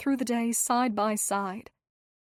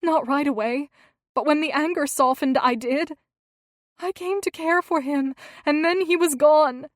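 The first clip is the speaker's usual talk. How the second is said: tearful; 6 semitones higher, 8 dB louder than usual. A narrator's reading. Tearful confession of grief.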